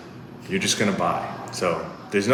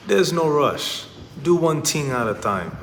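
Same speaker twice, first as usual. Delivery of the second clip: put-on light Jamaican accent, raised pitch; easygoing older Jamaican man's accent; 4 semitones higher than usual. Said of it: "in his Jamaican accent".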